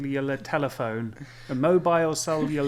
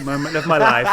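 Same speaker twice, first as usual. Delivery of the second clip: laughing; 9 dB louder than usual. Real, not acted. Laughing through the joke.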